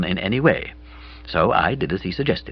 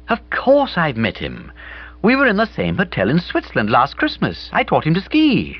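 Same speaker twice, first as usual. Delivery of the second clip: put-on higher, brighter girl's voice; Bobby's voice; 9 semitones higher than usual. A narrator's reading. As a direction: play Bobby, lively and breezy.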